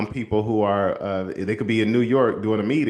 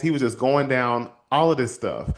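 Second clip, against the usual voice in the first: normal talking voice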